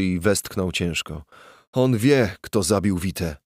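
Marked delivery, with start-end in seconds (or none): none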